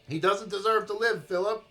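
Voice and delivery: furious sister. Acted. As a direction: spit it out in rage. Rochelle's furious reply.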